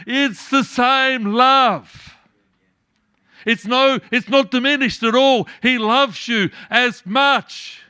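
preacher rising to a fervent climax; full-force preaching. Acted. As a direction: drive the point home to the congregation.

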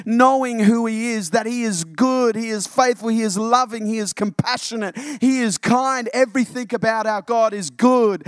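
loud, high, driving preacher cadence; rising pulpit fervour, punching each line; full-force preaching. Acted. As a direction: preach it hard to the church.

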